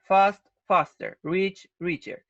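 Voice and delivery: slow, careful drill of each pair; teacher drills fast and rich pairs slowly; slow model words. Shown as a demonstration. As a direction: pronounce each word slowly for students to copy. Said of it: "As in the examples".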